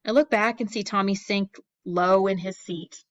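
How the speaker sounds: plain reading voice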